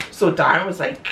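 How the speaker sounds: ordinary talk, nothing marked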